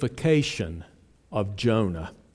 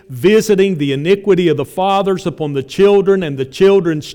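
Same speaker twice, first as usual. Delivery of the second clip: loud, raised scripture proclamation; full-force preaching; 6 semitones higher, 10 dB louder than usual. Real, not acted. Preacher proclaiming God's own words from Exodus, loud and forceful. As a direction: proclaim each phrase with ringing force.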